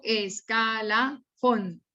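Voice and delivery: "escalafón" slowly in pieces, es, ca, la, fón; syllable separation. Shown as a demonstration. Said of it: "hacer la separación silábica". ("escalafón" lento por sílabas, es-ca-la-fón)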